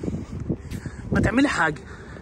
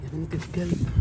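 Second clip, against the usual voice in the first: low, much softer exasperated curse; muttered curse; 4 semitones lower, 8 dB quieter than usual. Real, not acted. Cursing the runaway dog under his breath, quiet mutter. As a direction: grumble it under your breath.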